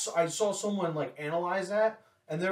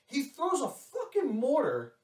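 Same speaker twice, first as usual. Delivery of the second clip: voice cracking; 9 semitones higher than usual. Real, not acted. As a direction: voice breaks up high on mortar. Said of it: "My voice cracked".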